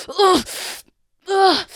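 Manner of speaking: loud, high pained grunts as he's lifted. Acted. Wounded prisoner hoisted onto a shoulder, loud pained grunts. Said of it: "you hear him just go".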